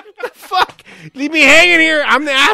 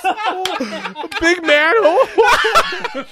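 laughing mockery; 8 semitones higher, 8 dB louder, much slower than usual. Mocking the dad's bragging.

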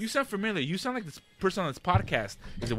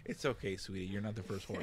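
mock consoling; 8 semitones lower, 13 dB quieter than usual.

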